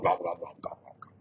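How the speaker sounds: hushed, breathy gobbling; the fire eater gobbles quietly; whispered gobble. Shown as a demonstration. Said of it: "making that noise".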